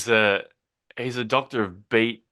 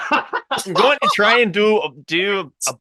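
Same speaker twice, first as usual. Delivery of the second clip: slow, halting go at an Australian accent; Australian accent attempt; 5 semitones higher, 3 dB louder, slower than usual. Shown as a demonstration. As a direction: try out an Australian accent, feeling for the sounds. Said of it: "in the Australian accent".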